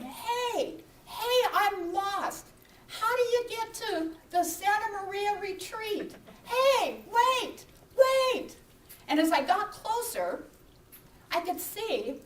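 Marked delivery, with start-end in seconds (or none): 0.0-8.6 high, drawn-out calling out; retold yelling. Lost walker calling after strangers, acted high-pitched yell. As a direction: re-enact hailing strangers, getting louder. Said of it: "and I was yelling".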